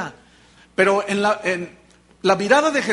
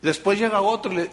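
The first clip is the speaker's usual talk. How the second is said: usual speaking voice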